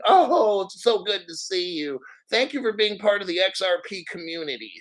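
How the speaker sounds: high, sugary mock fan voice; mocking gushing fan. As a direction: sneer out a gushy fan greeting. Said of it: "And I'm like".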